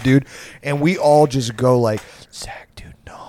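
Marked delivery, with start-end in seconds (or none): 2.3-3.2 near-whispered mock plea; hushed pleading. Friends quietly stopping drunk buddy, whispered plea. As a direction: hiss it very softly to hush a friend.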